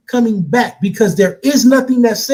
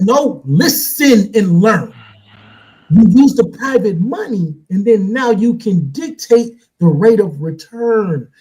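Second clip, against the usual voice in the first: slow lecturing; 5 semitones lower, much slower than usual. Real, not acted. Lecturing the group.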